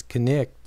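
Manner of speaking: connect, two syllables with the k sounded, kuh-NIKT; Old English pronunciation. Shown as a demonstration. Lecturer showing how knight once sounded, connect as kuh-NIKT. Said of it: "pronounced in Old English with two syllables".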